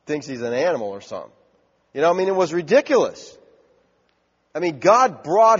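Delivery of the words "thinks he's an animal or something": lower, softer, gossiping aside; lightly voiced servants mocking. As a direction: snicker about the king quietly. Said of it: "his servants are in there thinking".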